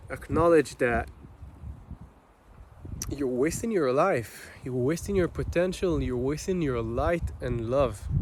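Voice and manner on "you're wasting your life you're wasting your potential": normal talking voice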